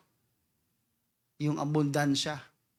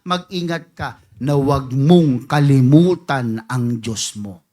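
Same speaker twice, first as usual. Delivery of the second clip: loud warning; 6 semitones lower, 11 dB louder, slower than usual. Real, not acted. Climax of warning.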